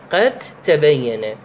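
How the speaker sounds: slow, merged "قتّبين"; dal merged into ta. Shown as a demonstration. Teacher showing idgham of dal into ta, very slow. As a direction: say "قد تبين" very slowly with the dal of "قد" dropped into a doubled t, "قتّبين". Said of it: "تقرأها هكذا".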